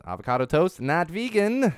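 high, loud punchline not vegan; mock outrage. Real, not acted. Host announcing avocado toast loses vegan status, high and loud. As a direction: hit the news high and loud.

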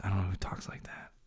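whispered aside; half-joking whispered remark. Real, not acted. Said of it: "whispered aside".

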